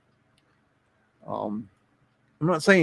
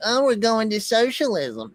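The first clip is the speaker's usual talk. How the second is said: mocking quote; 10 semitones higher than usual. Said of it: "a lot of people say".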